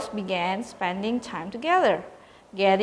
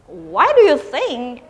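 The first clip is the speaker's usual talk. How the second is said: sharp, louder retort; snapping back; 5 semitones higher, 7 dB louder than usual. A narrator's reading. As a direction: bite back at her. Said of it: "Erin snapped".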